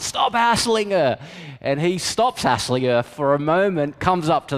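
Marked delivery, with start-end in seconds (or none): 0.0-1.2 higher, mock shout from a passing car; a driver's yell, lightly imitated; retold yell. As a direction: call out sharply, half-performed. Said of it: "the guy yells out".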